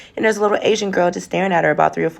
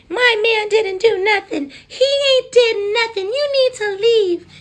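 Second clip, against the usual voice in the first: much higher, slow whiny crying protest; hammed-up sobbing defence of her man; mock tearful girlfriend; slower than usual. Drunk driver's girlfriend confronting her.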